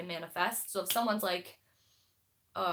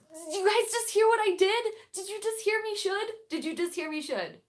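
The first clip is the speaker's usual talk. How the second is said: much higher, louder, playful alarm; shocked at herself; 11 semitones higher, 7 dB louder than usual. Real, not acted. Vlogger catching her own slip to viewers, high shocked alarm. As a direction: gasp at your own slip, voice jumping up. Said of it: "catching herself saying should".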